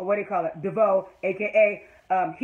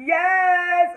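happy yes; 8 semitones higher, 6 dB louder than usual. Real, not acted. Fan cheering couple.

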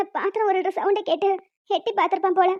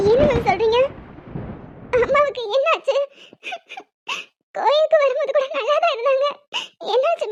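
high, tearful questioning; distressed alarm; 5 semitones higher than usual. Acted. Daughter asking what happened to her mother, distressed. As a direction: voice climbing in shock and worry.

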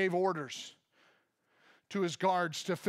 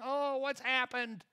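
mock trembling panic; 6 semitones higher than usual. Preacher mimicking a fearful churchgoer, mock trembling. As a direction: play the anxious soul who sees judgment in a gift. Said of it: "and start trembling".

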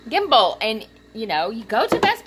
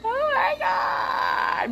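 slow, very high squeak; drawn-out giddy gasp of delight; thrilled squeal; much slower than usual. Real, not acted. Thrilled by the gimbal.

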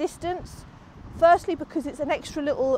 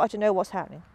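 normal talking voice

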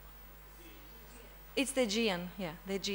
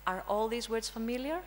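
ordinary talk, nothing marked

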